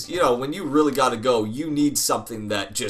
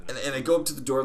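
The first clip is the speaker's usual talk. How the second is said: ordinary delivery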